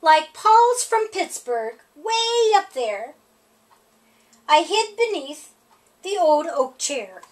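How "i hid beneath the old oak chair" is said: plain reading voice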